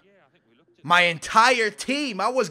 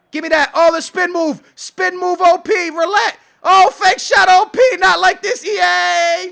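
high, loud running reaction; excited shouting; 9 semitones higher, 10 dB louder than usual. Real, not acted. Gamer protests overpowered moves to E A, frantic shouting. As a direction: cry out at each move as it happens. Reacting to spin move, fake shot.

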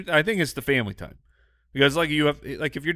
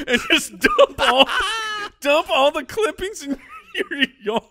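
high, helpless laughing delivery; laughing through words. Real, not acted. Co-host pictures neighbor dumping clippings, real high laughter. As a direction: squeal it out while cracking up. Cracking up at own absurd scenario.

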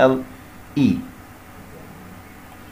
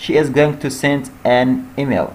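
every word stretched slowly; slow dictation; much slower than usual. Shown as a demonstration. Teacher reading the finished sentence slowly to students. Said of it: "بعدين الفعل في المصدر".

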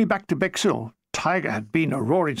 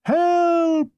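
high shouted call for help; 11 semitones higher, 3 dB louder than usual. Acted. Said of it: "I said".

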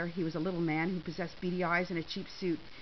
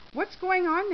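high, raised panicky question; frantic; 10 semitones higher, 7 dB louder, slower than usual. A narrator's reading. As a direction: demand answers in a panic for a friend. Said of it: "Natalie asked frantically".

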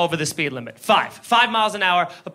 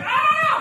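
Kermit the Frog. Retold or imitated. Driver screamed at by cop, high frog-puppet shriek. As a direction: squeal in puppet-like panic. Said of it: "I turn into Kermit the Frog".

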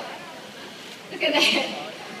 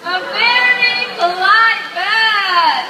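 drawn-out showman praise; 4 semitones higher, 7 dB louder, much slower than usual. Acted. Praising parrot to audience.

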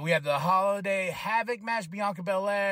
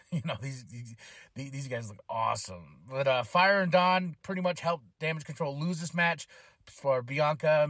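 ordinary talk, nothing marked

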